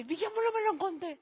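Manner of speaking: high, quick mock plea; pleading offer. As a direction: push the ask up high and fast. Mimicking a member offering money.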